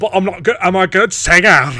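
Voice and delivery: loud, high hammed-up protest; mock indignant protest. Singer character defending herself, mock indignant shout. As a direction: belt out the offended singer's retort.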